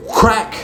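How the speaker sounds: whip crack. Retold or imitated. Triple H bust out the whip.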